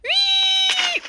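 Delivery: very high, loud held "wee"; excited squeal. Real, not acted. Gamer whooping with glee, loud high squeal. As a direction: let out a long shrill whoop.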